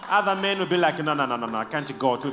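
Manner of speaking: usual speaking voice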